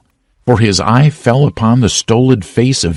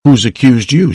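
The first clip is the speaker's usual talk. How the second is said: higher, sharp challenging shout; shouted question; 6 semitones higher than usual. A narrator's reading. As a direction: throw the question at him from the crowd. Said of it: "shouted a voice".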